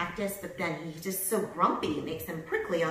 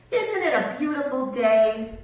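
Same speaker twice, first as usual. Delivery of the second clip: loud, higher-pitched tumbleweed call; 6 semitones higher, 9 dB louder than usual. A narrator's reading. Rosie the tumbleweed chats to Hank, calling out. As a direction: call it out brightly to a neighbor. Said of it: "Rosie the tumbleweed called out".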